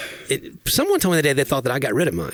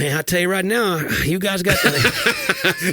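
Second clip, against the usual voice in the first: higher, very slow drawl breaking into a laugh; thick Southern drawl; 7 semitones higher, slower than usual. Retold or imitated. Host showing how he talks at the auto shop, drawling. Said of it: "I'm just gonna be like".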